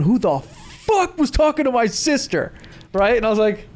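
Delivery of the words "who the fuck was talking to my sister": mock angry yell. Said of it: "I just hear some dude going".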